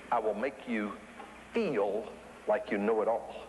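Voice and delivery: pause, then draw out "feel", low and slow; stress on feel. Shown as a demonstration. Habit promising the listener false mastery, "feel" heavily stressed. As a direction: lean on the word "feel", stretching it. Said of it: "I'm emphasizing the word feel".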